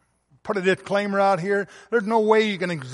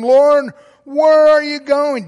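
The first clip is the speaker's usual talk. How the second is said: raised, louder urgent question; Peter's eager question; 5 semitones higher, 7 dB louder, slower than usual. Acted. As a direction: call out to Jesus with alarm. Peter's question voiced in sermon.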